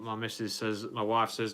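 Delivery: ordinary delivery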